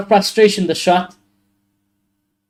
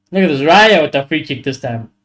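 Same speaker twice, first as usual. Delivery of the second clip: usual speaking voice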